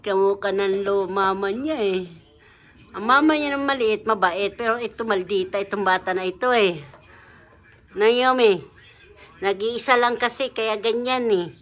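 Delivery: loud excited talk. Real, not acted. Loud, excited talk beside sleeping child.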